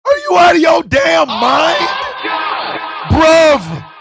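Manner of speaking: loud, high, outraged yell; outraged shout. Real, not acted. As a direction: yell in disbelief at chat. Outraged reaction to chat.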